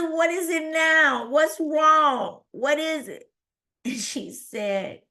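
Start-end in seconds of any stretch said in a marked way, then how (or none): 0.0-3.3 high, upset string of questions; alarmed demand. Retold or imitated. Patient questioning the nurse, alarmed. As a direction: plead for answers in a raised voice.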